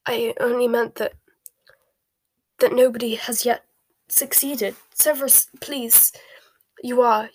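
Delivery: faltering, then urgent pleading; losing her nerve. A narrator's reading. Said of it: "seemed to lose her nerve".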